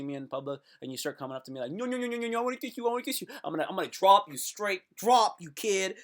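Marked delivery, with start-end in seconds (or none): 1.7-3.3 high, babbling kiss-crazed fan; mock overeager fan. Fan rushing up to the comedian in public, mock overeager. 3.4-6.0 loud, raised, punched-out warning; mock threat. Warning an overeager fan to back off, mock threat.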